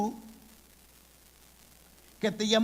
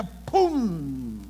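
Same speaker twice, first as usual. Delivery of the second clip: a sudden loud "pum!" burst; imitated boom; 5 dB louder than usual. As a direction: punch out the blast like fire hitting rock. Preacher imitates fire striking rock.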